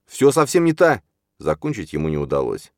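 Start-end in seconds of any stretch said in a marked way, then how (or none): 0.1-1.0 loud, high outcry cut short; desperate protest. A narrator's reading. Числав protesting before his father strikes, desperate shout. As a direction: shout the denial until cut off.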